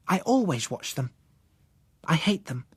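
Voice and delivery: put-on high, plain child voice, matter-of-fact; hating them is reason enough; flat girl. Susan stating her hatred to Bill, high child voice. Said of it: "she said".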